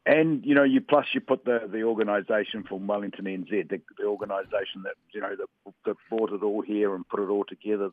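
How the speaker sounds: ordinary delivery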